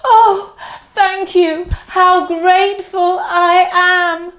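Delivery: lightly voiced mother, high and tearful; a hint of a tearful mother; grateful mother. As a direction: thank him with a catch in the voice. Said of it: "The mother says".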